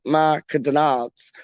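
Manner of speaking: Macadonalds drawn out with an extra syllable; posh pronunciation. Acted. Man taking his girl out, posh Macadonalds. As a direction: make Macadonalds sound like a fancy restaurant. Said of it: "That sounds like posh".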